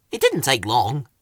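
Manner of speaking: usual speaking voice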